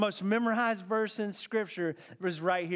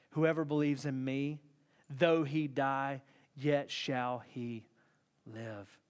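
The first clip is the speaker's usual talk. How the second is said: solemn reassurance; 7 semitones lower, 5 dB quieter than usual. Retold or imitated. Jesus looking her right in the eye, solemn. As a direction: say it low, with long pauses.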